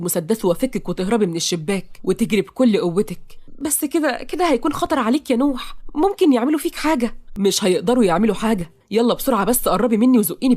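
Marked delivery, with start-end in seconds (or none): 3.6-7.1 high-pitched anxious objection; worried heroine. Acted. Heroine objects that his plan is dangerous, worried. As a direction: object fearfully in a raised voice.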